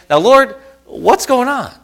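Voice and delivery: upset plea. Retold or imitated. Preacher voicing his angry question to God, loud high plea. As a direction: cry out at heaven, baffled and hurt. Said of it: "then I got more upset".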